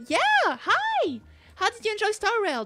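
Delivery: high, loud, bright hello; excited greeting. Real, not acted. Streamer greeting a viewer, excited. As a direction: burst into a high-pitched greeting.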